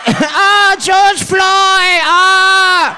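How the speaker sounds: high, loud wailing shout; hammed-up mimicked lament.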